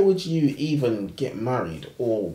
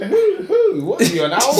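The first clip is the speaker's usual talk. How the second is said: high, loud mock voice of the joker; mocking quote; 8 dB louder than usual. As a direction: bark out the owl joke high and loud. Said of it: "man said".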